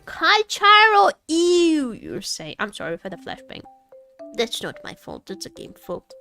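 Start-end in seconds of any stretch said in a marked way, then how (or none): 0.0-1.9 sudden high shout, drawn out; loud exclamation. Pretend. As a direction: blast it out after a hush. Sudden loud chant after hushed take.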